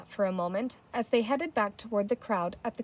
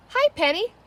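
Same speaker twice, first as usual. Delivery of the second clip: high, louder sing-song hello; bright greeting; 11 semitones higher, 6 dB louder than usual. A narrator's reading. Guilty girl greets her sister, high bright hello.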